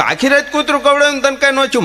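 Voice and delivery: high, fast disbelieving question; amazed question. Retold or imitated. Teller asking the stammerer, amazed, high and fast. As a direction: fire it off in disbelief.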